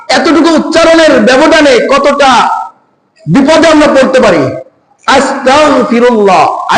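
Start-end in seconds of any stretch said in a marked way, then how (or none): 5.1-6.8 আস্তাগফিরুল্লাহ spelled out slowly with গ; drawn-out teaching আস্তাগফিরুল্লাহ; slowly, letter by letter; slow careful pronunciation. Shown as a demonstration. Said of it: "আলিফ সিন তা গইন".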